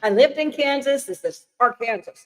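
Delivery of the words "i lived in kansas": laughing. Real, not acted. Laughing at her own slip.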